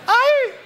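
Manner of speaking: sharp high yelp of pain; a sudden burning-oil ouch, high and loud; pained cry. Shown as a demonstration. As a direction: shriek ay as if burned by hot oil. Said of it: "¿qué dices?".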